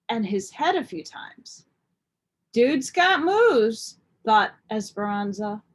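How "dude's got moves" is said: impressed. Acted. Esperanza impressed by Bo.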